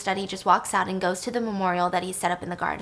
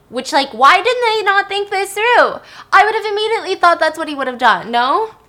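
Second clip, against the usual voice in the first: exasperated; 10 semitones higher, 8 dB louder than usual. Real, not acted. Host exasperated at plot choice.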